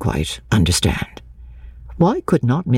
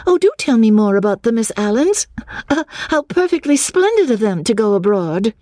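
high-pitched eager exclamation; raised, gushing high voice; 8 semitones higher than usual. A narrator's reading. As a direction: exclaim it high, full of interest. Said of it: "exclaimed in a high voice".

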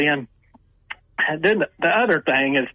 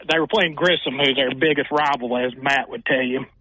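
ordinary delivery

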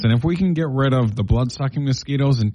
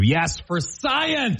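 slow and high, mock solemn; drawn-out proclamation; 9 semitones higher, much slower than usual. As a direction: stretch it like a grand motto. Echoing a mock-solemn motto.

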